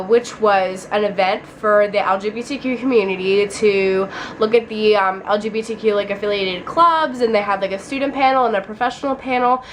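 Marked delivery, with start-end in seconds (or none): none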